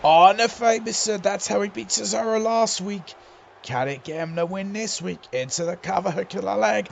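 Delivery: lively play-by-play call; wrestling commentator. Acted. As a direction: call the match as it happens.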